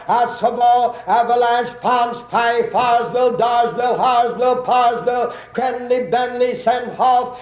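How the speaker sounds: steady sing-song station call; train announcer. Acted. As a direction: rattle off stations like a platform caller.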